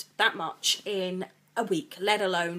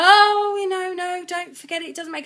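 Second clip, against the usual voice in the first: high flustered protest; 10 semitones higher, 11 dB louder than usual. Retold or imitated. To Darren offering help, a high flustered protest. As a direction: voice shoots up high and loud, flustered.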